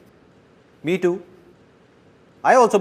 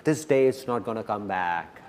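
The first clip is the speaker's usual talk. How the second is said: lower, softer repeat of a point; softened echo; 5 semitones lower, 6 dB quieter than usual. Real, not acted. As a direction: let it sink in, low and soft. Softer echo of emphatic line.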